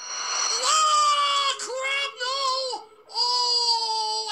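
sudden panicked shout, high and loud; frightened yell. Real, not acted. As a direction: yelp in real fright. Jump scare in horror game.

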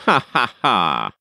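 the magician's gloating cackle; mock evil laugh. A narrator's reading. Magician alone, plotting the boy's end, gives a gloating cackle. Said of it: "help saying to himself".

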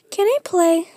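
high, loud little pup voice; acted high-pitched request; pleading pup. Pup asks mom to play.

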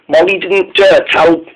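ordinary delivery

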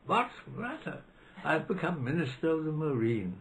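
high, clipped mock-upper-class chap; hammed-up posh voice, pitched high; mock posh rower. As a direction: play the breezy Cambridge chap apologising to the crew. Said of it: "came and said".

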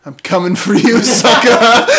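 taunting shout. Acted. Taunting enemy in game.

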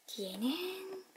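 soft breathy aside; whisper. Real, not acted. Host quietly turning the toy around, whispering. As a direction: say it under your breath.

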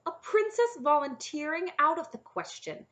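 high, loud and incredulous; scandalised dragon protesting; indignant refusal. A narrator's reading. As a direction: dismiss the idea as outrageous. Objecting dragon rejects the offer outright.

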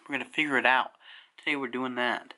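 lips clamped on small bulb; speaking around held object; talking with bulb in mouth. Real, not acted. Seen 0: String-light bulb held between lips while speaking; gone by +3.3.

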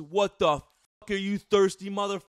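loud, high mocking impression of a shouting local; yelling aggressive guy. Imitating Fishman Joe yelling at them by the pond. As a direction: bellow it like a furious bro with a camera.

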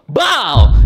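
loud punchy bow sound effect. Retold or imitated. Landing the elbow on grandma, loud bow impact. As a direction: punch out the hit.

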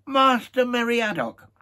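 high, loud, slow mock-formal call; Aragorn addressing Merry. Aragorn teasing Merry, lofty mock-solemn address. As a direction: call the hobbit by his full name, grandly. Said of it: "said Aragorn".

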